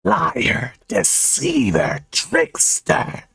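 high, drawn-out ghostly accusation; wailing high-pitched accuser; screaming ghost. A narrator's reading. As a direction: shriek each word slowly as a wronged ghost. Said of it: "She pointed and screamed".

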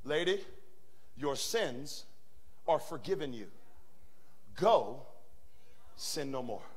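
soft, low gentle forgiveness; tender Jesus voice. Acted. Jesus forgiving the woman, quiet and tender. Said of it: "I'm gonna tell you how she died".

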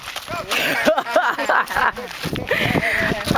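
high, delighted laugh. Real, not acted. After shake it girl.

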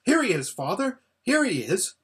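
put-on high, eager boy's call; excited cry. A narrator's reading. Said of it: "cried Corin".

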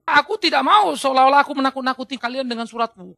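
loud declaimed denial; preacher acting a forceful rebuttal; emphatic quoted protest. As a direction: protest firmly as the apostle. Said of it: "oleh karena itu dia mengatakan".

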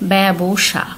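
ব্যবসা said "bebsha", with an e vowel and the first ব silent in the middle; ব্যবসা pronounced "bebsha"; teacher pronunciation. Shown as a demonstration. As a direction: model ব্যবসা as "beb-sha". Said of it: "উচ্চারণ হচ্ছে".

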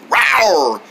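playful single rawr; pretend tiger roar.

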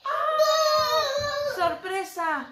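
high sung "Ah!", bright "Sorpresa!"; mock surprise. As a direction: sing out the reveal for the child. Lift-the-flap reveal for child.

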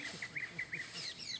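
high squeal of a laugh; high squeaky laugh. Real, not acted. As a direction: let a squeaky giggle out. Laughs after mock fuss.

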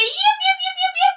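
squeaky little dog yapping; little dog yip. Pretend. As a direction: yip like a tiny puppy. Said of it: "Maybe you're a little dog and you say".

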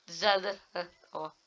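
high cracking laugh-cry. Real, not acted. Gamer laughing helplessly as the glitch fixes itself. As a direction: laugh out the word in relief.

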